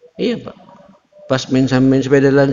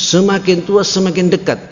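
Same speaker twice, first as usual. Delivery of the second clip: preaching refrain; 10 semitones higher than usual. Acted.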